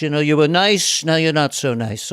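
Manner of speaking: lightly mimicked politician's put-down; a hint of an impersonated politician passing judgement; Donald Trump. Telling someone they've fallen out of favour, light Trump impression. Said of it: "how do you define Donald Trump".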